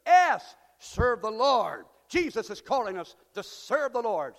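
loud slow proclamation; preaching at full force. Acted. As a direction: announce the point like a banner. Preacher announcing point.

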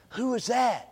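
soft, wondering question; hushed wonder. Retold or imitated. Boaz asking his workers about Ruth, hushed. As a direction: ask it under your breath, smitten. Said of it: "says Boaz".

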